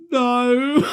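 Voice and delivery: very high, loud comic wail; mock wail. Son answering dad from the toilet, high mock wail. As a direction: wail it in hammed-up misery.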